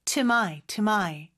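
"To my" passed over quickly, as one unit; brisk, reduced demo of "to my"; quick linking. Shown as a demonstration. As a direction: run "to my" together fast, twice. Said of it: "요 부분은 빨리 지나가요".

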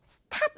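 스탑 with an airy Korean t after the s; Korean-style stop. Shown as a demonstration. Teacher showing learners the awkward version, Korean-style 스탑. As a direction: say 스탑 with a fully aspirated t. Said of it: "너무 스탑 하면은 약간 어색하게 들릴 정도거든요".

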